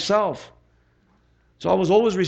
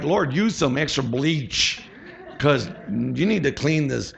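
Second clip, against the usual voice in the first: slow pleading prayer; slower than usual. Retold or imitated. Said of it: "some days I was like".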